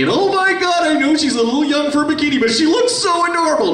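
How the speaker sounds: squealing way up high over his daughter; gushing proud dad. Shown as a demonstration. Doting father on the phone gushing about his daughter, high squeal. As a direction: let it fly up into a giddy gush. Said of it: "his voice is a little bit more like this".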